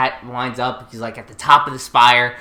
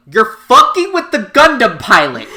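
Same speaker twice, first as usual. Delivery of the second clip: loud, higher indignant outburst; mock indignant yell; 7 semitones higher, 9 dB louder than usual. Lelouch imagined warning bullies, loud mock outrage. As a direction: yell it like a cocky rival. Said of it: "It's more like".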